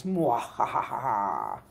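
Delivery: slow low villain laugh; evil cackle. Pretend. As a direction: cackle like a mad scientist. Villain laugh after "I have a plan.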